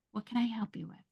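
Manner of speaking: put-on calm parent softening for a child; softened. Shown as a demonstration. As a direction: ease the voice down to a gentle offer. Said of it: "And just soften".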